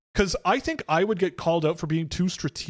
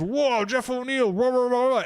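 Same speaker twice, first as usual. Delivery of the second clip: higher, hammed-up cheering crowd; mock fan cheer; 7 semitones higher than usual. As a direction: lampoon dumb fans whooping. Mocking fans cheering Jeff O'Neill.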